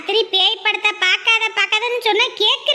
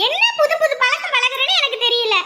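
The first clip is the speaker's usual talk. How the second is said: usual speaking voice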